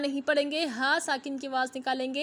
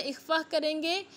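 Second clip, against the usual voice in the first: ordinary talk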